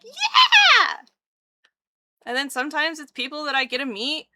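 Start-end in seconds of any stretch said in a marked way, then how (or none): 0.0-1.0 high, loud squeal of excitement; re-enacted hyped scream; pumped-up cheer. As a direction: shout it like you're hugely pumped. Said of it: "I get real pumped".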